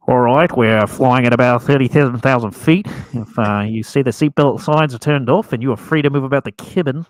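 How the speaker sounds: loud New Zealand pilot announcement; broad kiwi accent. Retold or imitated. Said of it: "a thick New Zealand accent".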